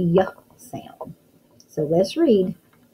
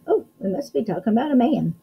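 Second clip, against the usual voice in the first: normal talking voice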